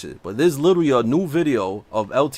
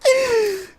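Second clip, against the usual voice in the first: high burst of laughter. Real, not acted. Laughing at the impression.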